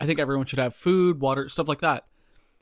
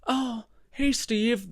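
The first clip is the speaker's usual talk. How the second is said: fake rich lady; 8 semitones higher, much slower than usual. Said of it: "She's like".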